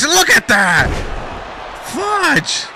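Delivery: sudden high-pitched burst of shock; excited match call. Real, not acted. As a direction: call the big hit, jumping up in pitch. Commentator reacts to a big hit.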